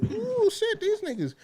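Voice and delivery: high, hushed "uh-oh" voice; mock alarm. Acting out spotting the rally.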